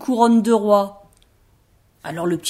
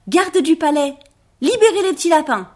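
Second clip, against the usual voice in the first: commanding; 7 semitones higher, 6 dB louder than usual. Acted. Rabbit orders the guards.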